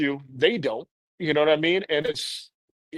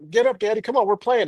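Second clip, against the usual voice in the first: put-on higher, louder child urging; little girl voice; 6 semitones higher, 3 dB louder than usual. Daughter urging her dizzy dad to get up, higher child voice. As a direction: nudge daddy to keep playing. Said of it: "she's like".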